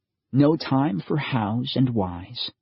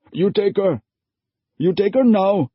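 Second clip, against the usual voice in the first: higher, louder, urgent father voice; father pleading, raised and strained; desperate father; 10 semitones higher, 5 dB louder than usual. A narrator's reading. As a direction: push her into the stranger's arms. Father pushes her forward.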